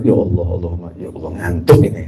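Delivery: low, soft sleepy groaning; heavy-eyed, quiet complaint to God; acted drowsiness. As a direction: sigh it out as if nodding off. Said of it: "Padahal ngantuknya luar biasa".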